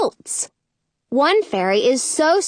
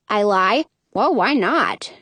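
plain reading voice

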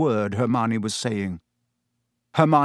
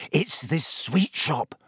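dreamy; 3 semitones higher, slower than usual. A narrator's reading. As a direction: drift off, dreaming of sweets. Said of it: "a dreamy look coming over his face".

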